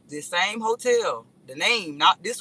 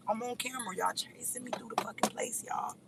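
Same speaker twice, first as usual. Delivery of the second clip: whisper; 5 semitones higher, 11 dB quieter than usual. Real, not acted. Hushed aside while on camera.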